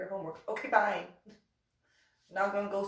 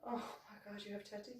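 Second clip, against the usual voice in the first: mock shocked; 14 dB quieter than usual. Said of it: "And you're just".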